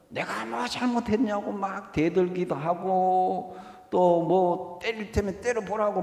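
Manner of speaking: a hint of a talking-back teenager; defiant child. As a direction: sass back like a cheeky child. Defiant child voiced in sermon.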